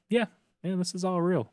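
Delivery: high-pitched mock agreement; lightly put-on high voice; easy agreement. Voicing the group's ready belief.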